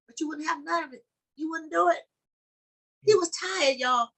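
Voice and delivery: stirring sermon climax; fervent preaching. Acted. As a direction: preach it up to the congregation. Preacher building to full force.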